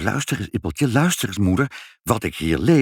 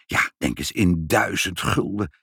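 plain reading voice

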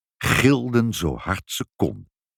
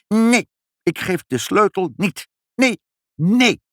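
high, vehement no; furious refusal; 12 semitones higher, 4 dB louder than usual. A narrator's reading. Pinkepank refusing mother the key, high vehement refusal. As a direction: refuse shrilly, rising to louder.

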